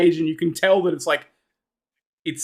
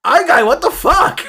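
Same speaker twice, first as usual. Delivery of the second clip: high-pitched goblin protest; indignant goblin; 7 semitones higher, 4 dB louder than usual. Acted. Goblins react to the overheard escape plan, indignant. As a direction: squawk it in offended disbelief. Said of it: "the goblins are like".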